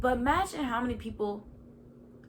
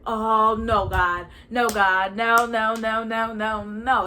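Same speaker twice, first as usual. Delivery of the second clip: louder, pleading no, no, no; loud panicked no; 8 dB louder, much slower than usual. Retold or imitated. Refusing God's call to get a master's, loud pleading no. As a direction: beg God to take it back.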